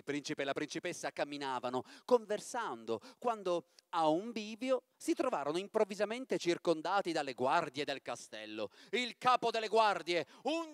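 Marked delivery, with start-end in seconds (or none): none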